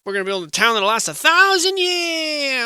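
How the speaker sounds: hammed-up settler who refuses another step; stubborn settler. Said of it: "who just decided to stop and said".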